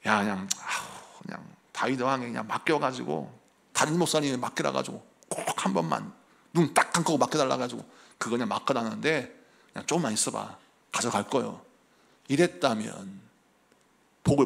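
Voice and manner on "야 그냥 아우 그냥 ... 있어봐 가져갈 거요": softer, lower mumbling father; father's hushed brush-off. Retold or imitated. Preacher voices the father quietly brushing off his son's warning. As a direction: wave the boy off quietly, like a reluctant dad.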